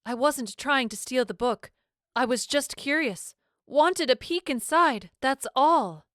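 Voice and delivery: high, trembling upper-class British voice; a frightened British baroness pleading; fearful British accent. A narrator's reading. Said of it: "lofty British accent ... fearful quiver".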